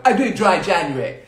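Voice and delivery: high, very loud show-off voice; mocking loud braggart; mock loud boasting. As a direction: brag it loudly for all to hear, hammed up. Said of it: "Do it in a really loud voice".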